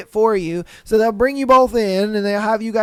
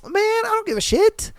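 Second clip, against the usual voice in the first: much higher, careless brush-off; dismissive retort; 10 semitones higher than usual. Retold or imitated. Brushing off coworkers' complaints, high careless retort. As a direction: toss it off like you couldn't care less. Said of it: "I'm like".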